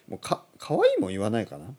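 ordinary talk, nothing marked

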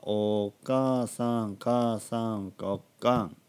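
"お母さん" stretched long, shrinking to "おかん"; slow demo of "お母さん" contracting to "おかん"; gradual shortening. Shown as a demonstration. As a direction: drawl "お母さん", then "母さん", then "おかん". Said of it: "とにかく短くなってね".

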